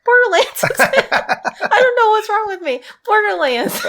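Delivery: high, loud laughing squeal; helpless giggling. Real, not acted. Player tickled at the game name the host supplied, giggling. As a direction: squeal the words through real laughter. Cracking up over the word.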